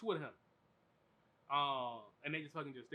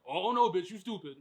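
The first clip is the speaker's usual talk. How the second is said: loud jeer at the screen; loud mocking outburst; mock heckle; 12 dB louder than usual. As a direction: bark it like a rude moviegoer. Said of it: "Someone says".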